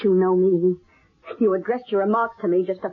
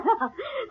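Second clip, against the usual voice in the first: sudden high unhinged laugh; mad laugh; 13 semitones higher, 4 dB quieter than usual. Acted. Killer laughing off prison before the psychic, high and unhinged. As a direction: laugh like she has lost her grip.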